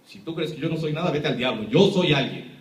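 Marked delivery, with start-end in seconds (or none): none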